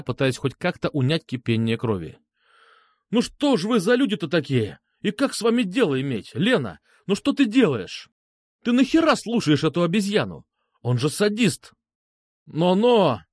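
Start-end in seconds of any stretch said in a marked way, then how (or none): 3.1-11.7 raised-pitch, heated reproach; exasperated scolding. Acted. Narrator reproaches Lena for listening to a sadist, exasperated scolding. 12.5-13.2 higher-pitched warning protest; indignant exclamation. A narrator's reading. Vitya objects to being called a sadist, indignant exclamation. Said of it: "воскликнул Витя".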